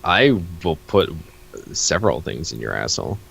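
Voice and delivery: ordinary talk, nothing marked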